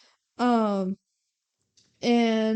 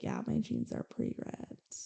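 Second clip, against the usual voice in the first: voiced as a near-whispered, breathy mumble; half-whispered, uninterested musician; mumbled reply; 12 dB quieter than usual. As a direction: mumble it like a bored rock star barely answering. [name] answering interview question.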